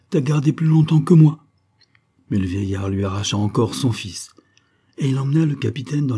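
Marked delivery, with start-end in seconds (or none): 0.1-1.4 high, loud complaint; indignant, raised voice; motherly protest. A narrator's reading. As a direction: protest jealously for your turn. Mother protests, wanting her son.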